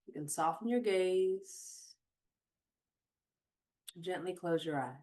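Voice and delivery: unhurried meditation voice with long pauses; guided meditation. Acted. Host guiding listeners to notice their surroundings, guided meditation. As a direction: guide them gently, phrase by phrase, leaving space.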